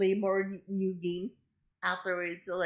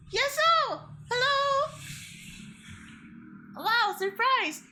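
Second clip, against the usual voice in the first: excited greeting; slower than usual. Real, not acted. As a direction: sing out the hello to a new guest. Greeting a surprise guest joining.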